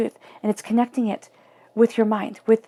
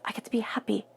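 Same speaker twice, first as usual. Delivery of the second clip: hushed whisper of quiet joy; 7 dB quieter than usual. Acted. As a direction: breathe it out softly. Soft whisper ending a build.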